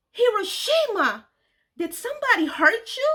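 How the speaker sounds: high, loud alarmed outcry; cried out in alarm. Retold or imitated. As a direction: call out, suddenly worried for her. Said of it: "she cried out in alarm".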